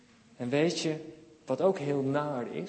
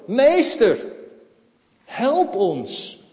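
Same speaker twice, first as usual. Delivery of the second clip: raised calling voice, higher at first; acted shout; 5 semitones higher, 8 dB louder, much slower than usual. Sick men calling Jesus from afar, raised pleading cry. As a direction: call out from a distance for help. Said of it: "ze schreeuwen".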